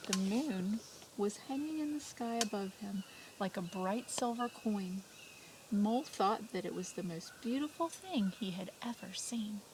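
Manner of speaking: hushed storybook reading; reading to children. Acted. Teacher reads story aloud.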